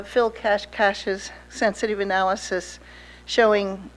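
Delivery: usual speaking voice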